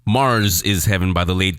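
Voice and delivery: ordinary delivery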